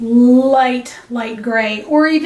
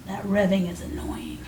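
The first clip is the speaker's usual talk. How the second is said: whispering; 2 semitones lower, 8 dB quieter than usual. Real, not acted. Whispered complaint about neighbor.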